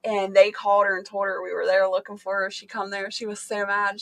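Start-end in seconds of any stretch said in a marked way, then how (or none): none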